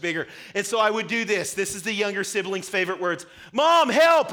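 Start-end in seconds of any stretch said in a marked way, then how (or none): 3.5-4.3 put-on high, loud desperate kid's cry; calling for mom. Pinned little brother calling his mom, high desperate yell. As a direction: yell for mom like a trapped kid.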